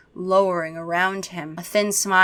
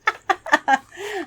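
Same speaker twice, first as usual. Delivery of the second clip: laughing; 11 semitones higher than usual. Real, not acted. Laughs at own voice.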